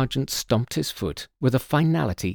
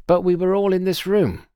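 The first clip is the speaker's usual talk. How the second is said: higher, louder protest; protesting cry; 8 semitones higher, 5 dB louder than usual. Acted. Guest protesting to the doctor, loud and high. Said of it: "cried Adam Kinter".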